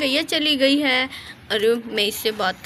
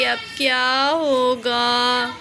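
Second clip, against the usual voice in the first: mock crying; much slower than usual. Radha despairs at messy house.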